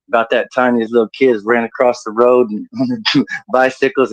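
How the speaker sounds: normal talking voice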